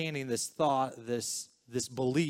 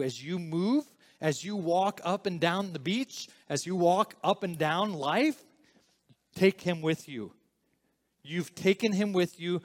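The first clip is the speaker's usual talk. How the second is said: building to full-force preaching, higher and louder; rising preaching; 5 semitones higher, 5 dB louder than usual. Real, not acted. Pastor pressing the congregation to take him along, building preaching. Sermon climbs to urgent exhortation.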